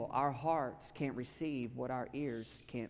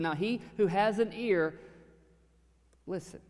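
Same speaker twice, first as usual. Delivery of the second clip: raised quote; 6 semitones higher, 4 dB louder than usual. A narrator's reading. Quoting Jesus, louder.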